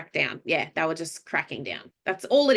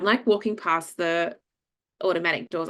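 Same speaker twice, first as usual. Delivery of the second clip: usual speaking voice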